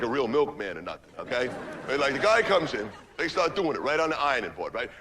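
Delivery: ordinary talk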